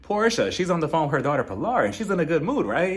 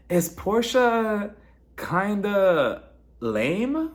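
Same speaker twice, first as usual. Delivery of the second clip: drawn-out question; 5 semitones higher, much slower than usual. Real, not acted. Pointed slow question.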